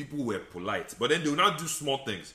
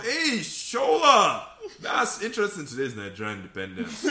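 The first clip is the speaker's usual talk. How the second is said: mock coworker greeting; 8 semitones higher, 4 dB louder than usual. Coworker remarking on his outfit, hammed-up mock greeting. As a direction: ham up a nosy coworker. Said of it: "And they'll come up".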